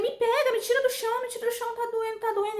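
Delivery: high-pitched, urgent begging; reenacted cry for help, pitched high; pained pleading. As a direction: plead to be lifted off the floor. Retold plea after painful fall.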